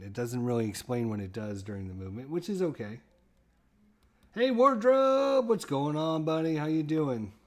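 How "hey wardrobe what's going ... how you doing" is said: high, loud cheerful hello; excited greeting. Real, not acted. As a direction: call out happily to a friend. Greeting a viewer by name.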